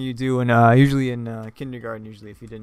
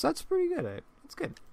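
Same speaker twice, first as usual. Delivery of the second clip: squeaky surprise; 7 dB quieter than usual. Real, not acted. Startled by creepy drawing.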